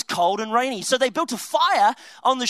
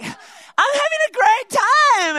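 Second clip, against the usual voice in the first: high, slow, louder mock-girly voice; hammed-up girl voice, much higher; girly sister voice; 10 semitones higher, 5 dB louder, much slower than usual. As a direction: drawl it out like a breezy teenage sister. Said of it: "that's how all girls speak".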